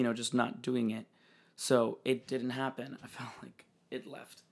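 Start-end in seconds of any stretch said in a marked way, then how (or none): 3.0-4.4 whisper. Real, not acted. Whispered aside.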